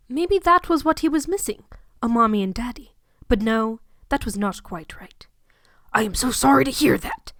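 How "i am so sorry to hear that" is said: empathetic Hagrid. Acted. Hagrid consoling the lonely boy, empathetic giant voice. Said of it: "Hagrid uttered empathetically".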